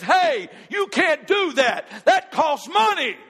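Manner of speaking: high, loud, sharp protest from the clerk; store clerk objecting. Retold or imitated. Store clerk shouting at the customer not to dial, loud objection. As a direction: cut him off loudly, as the clerk would. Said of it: "she says".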